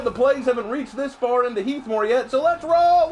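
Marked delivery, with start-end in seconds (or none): 2.3-3.1 high, loud battle cry; rallying shout. Real, not acted. Streamer kicking off the match, high rallying shout. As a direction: shout it to launch the charge.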